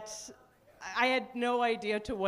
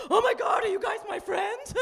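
panicked squeal, much higher and louder; paranoid high shout; 11 semitones higher, 3 dB louder than usual. Retold or imitated. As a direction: freak out mid-high. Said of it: "I'd be like".

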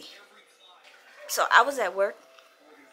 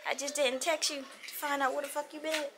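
ordinary delivery

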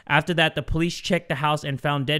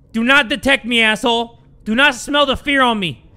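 loud, higher, hissed-out plea to the monster; scared gamer pleading louder and higher; tense loud plea; 5 semitones higher, 7 dB louder than usual. Real, not acted. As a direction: beg the monster loudly not to notice you.

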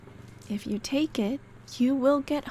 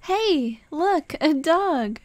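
excited; 4 semitones higher, 5 dB louder, slower than usual. Acted. Spotting a dog on the walk.

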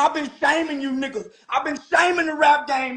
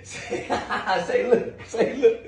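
ordinary talk, nothing marked

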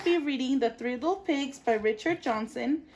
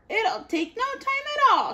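squeaky-high piggy voice; little pig chirping, carefree; 12 semitones higher, 3 dB louder than usual. A narrator's reading. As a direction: lift the voice way up for the first pig. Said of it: "she said".

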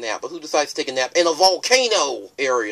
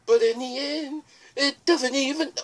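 mysterious ferryman; 9 semitones higher than usual. Acted. River Person musing.

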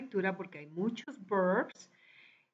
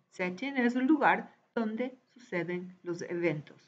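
ordinary talk

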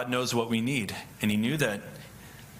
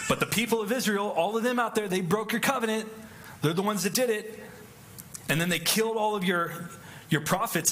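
high, pleading complaint, much higher than his own voice; whiny Elijah; 10 semitones higher than usual. Acted. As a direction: play Elijah as a self-pitying whiner.

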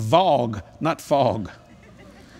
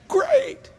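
very high sarcastic "Great." rising at the end; a squeaky, questioning "Great." going up; mock sarcasm. Mock envy of bigger plane.

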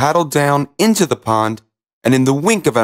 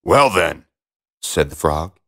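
low-pitched frog; lightly voiced low frog reply; frog character; 5 semitones lower than usual. A narrator's reading. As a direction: answer as an offended frog. Frog answers the princess.

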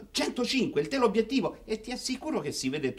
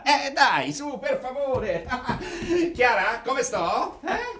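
laughing; 7 semitones higher, 4 dB louder, slower than usual. Acted. Cheerful father laughing through lines.

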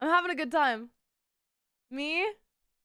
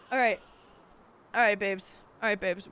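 ordinary delivery

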